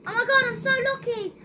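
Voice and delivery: fast high excited relief. Real, not acted. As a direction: rattle it off thrilled. Survives by luck.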